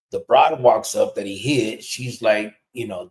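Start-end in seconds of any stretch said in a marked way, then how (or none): none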